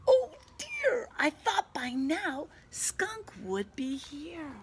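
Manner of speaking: high fretting bunny voice; a fretful little rabbit; worried bunny. Acted. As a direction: squeak it out like an anxious bunny. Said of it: "Bunny hops about".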